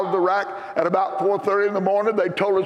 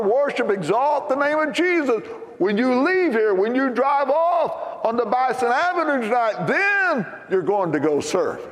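preaching; 2 semitones higher than usual. Acted. Preaching to congregation.

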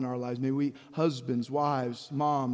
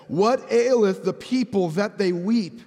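king Saul asking; 6 semitones higher, 7 dB louder, slower than usual. Acted. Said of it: "And Saul said".

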